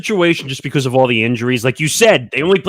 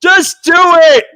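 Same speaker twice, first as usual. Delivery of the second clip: high, loud, slow urging; mock shout; 13 semitones higher, 7 dB louder, much slower than usual. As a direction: yell it like pushing someone to do it.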